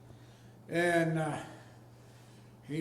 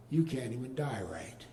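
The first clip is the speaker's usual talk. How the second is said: muttered under the breath, lower; a sly half-voiced jab at the dog; whispered aside; 6 semitones lower, 5 dB quieter than usual.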